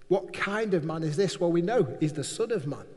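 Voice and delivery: ordinary talk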